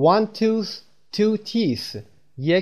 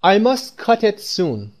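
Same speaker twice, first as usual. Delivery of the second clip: very slow, higher, stressing cut; slow, clear model sentence; 7 semitones higher, 4 dB louder, much slower than usual. Shown as a demonstration. Teacher modelling the sentence for Persian learners, very slow and higher. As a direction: spell it out slowly for learners, leaning on cut. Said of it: "باید بگیم".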